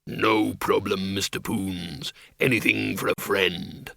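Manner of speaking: low creature voice; deep, friendly monster; bogeyman. A narrator's reading. Bogeyman Schleppel obliges Windle.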